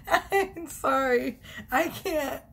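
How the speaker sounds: giggling through an apology, slow; can barely get the words out for laughing; helpless giggles while apologizing. Real, not acted. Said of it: "I can't even function on this reading".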